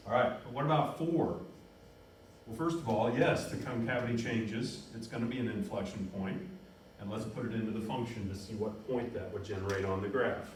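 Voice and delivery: ordinary talk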